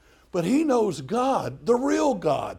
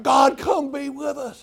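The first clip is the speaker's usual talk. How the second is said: slow, higher, loud plea; drawn-out shouted plea to God; mock pleading prayer; 6 semitones higher, 4 dB louder than usual. Preacher mimics a dumb prayer, slow loud plea. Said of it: "and then it's like".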